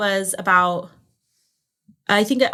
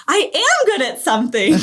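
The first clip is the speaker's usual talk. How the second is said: delighted laughing quote; 8 semitones higher, 4 dB louder than usual. Retold or imitated.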